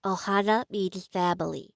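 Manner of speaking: cute, stuffed-nose little voice, softening at the end; super cute character voice. Shown as a demonstration. Student running the whole line, cute character voice. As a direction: go small and cute, fading softly on the last words. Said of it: "it's a super cute voice".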